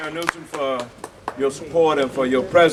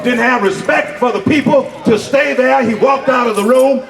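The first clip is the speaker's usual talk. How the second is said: higher, heated scolding of the room; angry denunciation; 7 semitones higher than usual. Real, not acted. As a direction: publicly condemn the heckler. Chair angrily denounces disruptive man.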